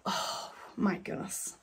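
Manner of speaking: slow, breathless whisper of awe; awed whisper. Real, not acted. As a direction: breathe it out, almost speechless. Overwhelmed seeing the painting.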